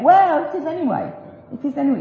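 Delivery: usual speaking voice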